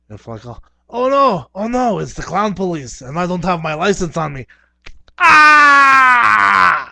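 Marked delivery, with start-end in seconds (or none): 0.4-4.5 loud high frantic protest to the officer; panicked pleading. Acted. Panicking clown stopped by police. 5.2-6.9 loud high hammed-up scream; mock scream. Clown police scream.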